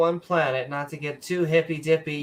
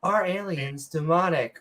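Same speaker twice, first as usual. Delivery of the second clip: usual speaking voice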